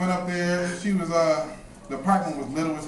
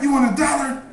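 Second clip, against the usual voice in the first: angry yell; 10 semitones higher, 4 dB louder than usual. Retold or imitated. Angry yell at begging kid.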